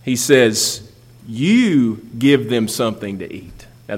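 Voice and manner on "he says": normal talking voice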